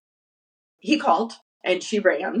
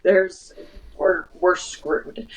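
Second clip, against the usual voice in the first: ordinary delivery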